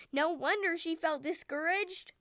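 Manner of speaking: ordinary delivery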